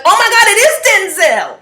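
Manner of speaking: high excited shout. Retold or imitated. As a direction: shout it out, starstruck. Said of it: "he heard me shout it".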